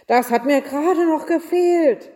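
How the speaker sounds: high, loud angry outburst; exasperated shout. A narrator's reading. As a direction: cry out as the fed-up innkeeper. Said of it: "schrie der Wirt".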